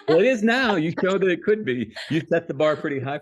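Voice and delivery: real laugh, loud and high; laughing retort. Real, not acted. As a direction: toss it back over your own laughter. Laughing reply to playful protest.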